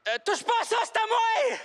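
high indignant yell; possessive shout. Acted. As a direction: snap it out like a child guarding food. Potato claims its food.

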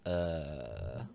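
ordinary delivery

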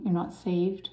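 ordinary talk

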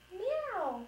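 high mewing kitten; kitten meow. Pretend. Mom voicing the kitten in the storybook, high meow. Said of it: "said the kitten".

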